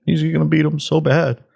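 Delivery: high, fast mimicry of smug pundits; mock-confident pundit impression; mocking media voice. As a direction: parody the confident newscasters. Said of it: "just like they did, against President Trump".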